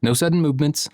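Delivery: high, hammed-up soothing as if facing a wild animal; mock surrender. Father recalling his hammed-up wary surrender to his angry son. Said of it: "I'll raise my hands in defeat".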